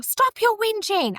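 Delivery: high mock scolding; high imitated rebuke; mimicking older brother. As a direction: bark it back as the bossy brother.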